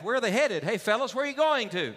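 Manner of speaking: high, eager voice of a traveller calling out; curious stranger. Acted. Traveller hailing two men on a dirt path, high and eager.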